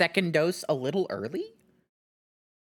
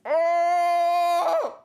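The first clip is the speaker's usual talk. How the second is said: usual speaking voice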